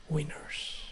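breathy whisper. Real, not acted. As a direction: whisper the word. Whispered title idea.

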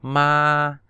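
Mandarin first tone on ma. Shown as a demonstration. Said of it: "ma là thanh một".

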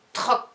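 "Troc" loud and brief, K after a gap; short dictation. Shown as a demonstration. Teacher repeating the first syllable, short and loud. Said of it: "des dictées de syllabes".

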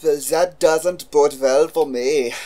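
dramatic voice. Acted. Dramatic ominous line.

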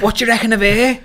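mates asking. Retold or imitated. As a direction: nudge him about the girl, loud and high. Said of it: "they're like".

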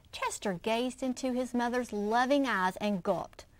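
put-on lively read-aloud for children; expressive picture-book reading; story narration. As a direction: read it like story time for kids.

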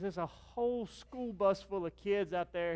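slow, high, drawn-out warning; friend warning. Retold or imitated. Friend describes the bus of kids, slow and high. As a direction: spell out the bad news slowly. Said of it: "He goes".

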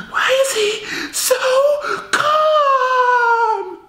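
very high, drawn-out whine; baffled girlfriend. Acted. Girlfriend about boyfriend, very high disbelieving whine. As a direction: squeal in disbelief.

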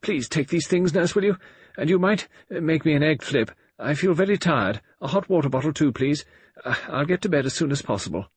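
high-pitched, peevish lady's orders; annoyed. A narrator's reading. Tired patient giving her nurse orders, annoyed. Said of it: "she was annoyed with Nurse Craven".

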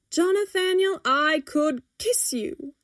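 high, drawn-out adoring cheer; chorus cheer. A narrator's reading. Customer gushing over free cookies, cheering. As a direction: gush it out slowly and high. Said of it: "A small chorus rings out".

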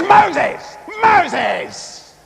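mock angry shout; angry, annoyed God. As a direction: yell the name in exasperation. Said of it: "perhaps he was angry and annoyed".

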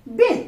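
बिन jerked out loud and short. Shown as a demonstration. Teacher drilling बिन with a short i. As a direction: punch बिन out with a quick i. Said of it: "एक झटके से sound आ रहा है".

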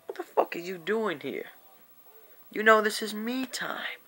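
put-upon whine about private time, softly; annoyed mock complaint. Speaker confronting the visitor about me time, annoyed.

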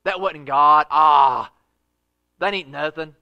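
loud dismissive scoffing heckler; mocking scoff. Preacher imitating a scoffer mocking believers, loud scoff. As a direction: sneer them down with a loud "Ah". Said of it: "you got somebody go".

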